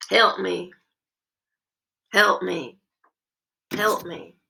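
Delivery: imitating a patient.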